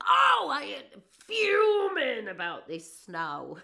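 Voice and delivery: high, slow, laughing mock complaint; lightly mocking a grumpy neighbour; mock fuming client. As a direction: ham up a whiny grumble about snow. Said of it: "they said".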